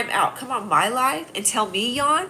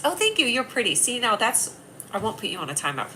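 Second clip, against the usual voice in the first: ordinary talk, nothing marked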